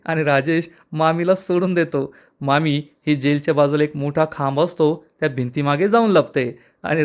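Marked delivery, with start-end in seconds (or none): none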